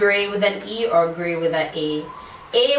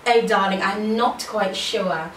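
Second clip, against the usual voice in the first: put-on British English, drawn-out words; British accent; slower than usual. Shown as a demonstration. Vlogger showing off for viewers, British accent. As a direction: demonstrate a slow British accent. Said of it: "American accent or British accent".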